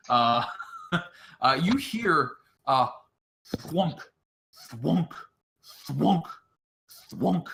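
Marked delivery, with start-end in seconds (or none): 3.6-7.5 thwunk, thwunk, like an axe biting wet wood; chopping sound effect. Shown as a demonstration. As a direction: imitate heavy chops, spaced out. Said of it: "it sounds like someone is chopping".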